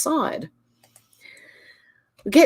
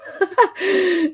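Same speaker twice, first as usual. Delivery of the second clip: high giggle; real amused giggle; laughing; 11 semitones higher than usual. Real, not acted. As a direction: burst into a high-pitched laugh. Amused by chat comment.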